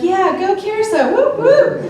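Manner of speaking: high-pitched cheer with whoops; friends cheering. Retold or imitated. Friends hype her up at the bar, high excited whooping.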